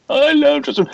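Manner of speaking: put-on high wailing little girl; mock sob it out; hammed-up crying fan; imitating crying kid. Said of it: "she starts crying".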